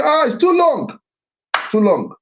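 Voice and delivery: high-pitched mock complaint about a long name; dismissive complaint. Speaker mimicking people who find his daughter's name too long, high and dismissive. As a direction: imitate someone brushing the name off as too long. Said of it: "they say".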